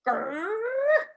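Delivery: high loud growl; bear growl. Acted. Bear reacts to being called bear.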